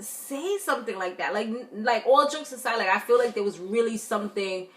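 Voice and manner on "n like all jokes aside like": ordinary talk, nothing marked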